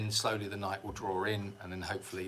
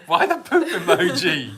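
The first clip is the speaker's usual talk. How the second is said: loud, high question bursting with laughter; laughing disbelief; 6 semitones higher, 13 dB louder, slower than usual. Real, not acted. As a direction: ask it incredulously, cracking up. Laughing at a viewer's poop emoji.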